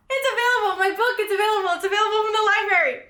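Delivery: squealing good news; excited shriek. Real, not acted. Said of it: "I'm so fucking excited".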